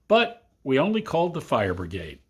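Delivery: ordinary delivery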